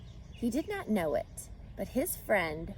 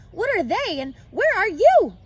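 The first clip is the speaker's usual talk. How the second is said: high, loud scream; 12 semitones higher, 10 dB louder than usual. Acted. Wilbur screaming for the hidden voice, high and loud. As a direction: scream out in desperation. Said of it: "screamed Wilbur".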